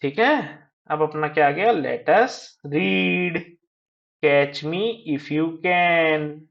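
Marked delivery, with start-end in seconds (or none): 1.7-6.4 very slow, word by word; reading out. Shown as a demonstration. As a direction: read it out slowly for learners. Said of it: "read out करना है".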